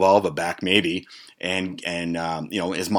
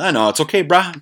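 higher-pitched placating friend voice; reassuring buddy; 9 semitones higher, 4 dB louder than usual. Acted. Friend telling Chris it's okay, reassuring. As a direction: talk him down in a raised pitch.

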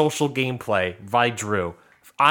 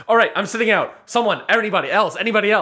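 raised-pitch, insistent rallying; mock urgent appeal; 7 semitones higher, faster than usual. Retelling Drew seeking others to sit out, mock urgent. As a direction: press the group for a volunteer.

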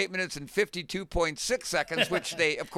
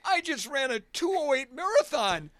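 half-thrilled, half-crushed retelling; disappointed disbelief; 8 semitones higher than usual. Retold or imitated. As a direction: voice rising high on a retold complaint. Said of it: "had never been so disappointed".

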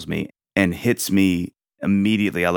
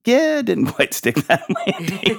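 high, slow, laughing self-mockery; inner voice, laughing; 12 semitones higher, slower than usual. Parent's inner voice admitting a fumble, laughing and high. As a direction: chuckle at your own flop, voice pitched way up. Inner voice after bad moment.

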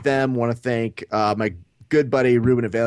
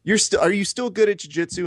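higher, rising to a squeaky shut up; exasperated mock rant; 9 semitones higher than usual. Telling a bronze medallist to stop moaning, exasperated high rant. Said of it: "And I go".

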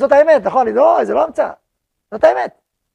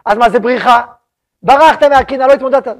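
challenging objection; 3 semitones higher, 10 dB louder than usual. Pretend.

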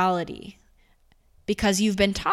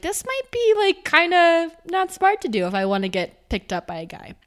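much higher, amused inner voice; mock realization; 9 semitones higher, 4 dB louder than usual. To herself about the ring, a high amused voice. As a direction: her younger self wising up. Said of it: "I was like".

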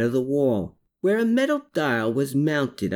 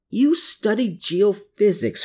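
higher-pitched grumbled comeback; grumbling retort; 8 semitones higher than usual. A narrator's reading. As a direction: grumble it right back, pitch raised. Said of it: "Herman grumbled back".

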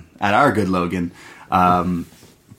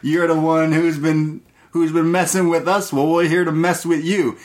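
pitched-up yokel threat; 7 semitones higher than usual. Retold or imitated. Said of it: "They're like".

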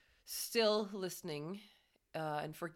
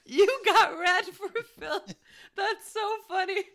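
high, loud, laughing through it; 12 semitones higher, 10 dB louder than usual. Real, not acted. [name] laughing at David's embarrassment. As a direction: squeal it out while laughing.

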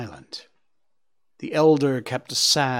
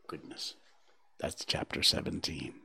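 ordinary delivery, nothing marked

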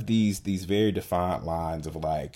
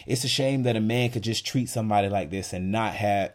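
normal talking voice

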